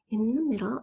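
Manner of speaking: ordinary talk, nothing marked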